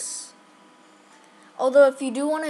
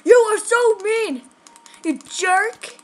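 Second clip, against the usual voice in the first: 1 angry yelling; 8 semitones higher, 5 dB louder than usual. Real, not acted. Angry at opponent in game.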